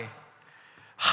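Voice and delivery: soft unvoiced sigh of exhaustion; weary sigh. A preacher showing how weary people sigh, soft sigh. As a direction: breathe out a tired sigh. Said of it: "한숨 날 때가 있지 않습니까".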